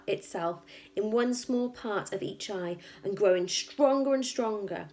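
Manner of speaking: usual speaking voice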